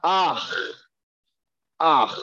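ach, with the vowel before a throaty ch; ach sound. Shown as a demonstration. Hebrew teacher demonstrating the final ach sound. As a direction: say ach like there's an aleph first. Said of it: "we pronounce it".

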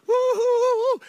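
high, loud party whooping; joyful whoop. Acted. Israelites celebrating the crossing, loud whooping. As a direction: whoop like a crowd dancing.

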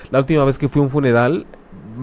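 usual speaking voice

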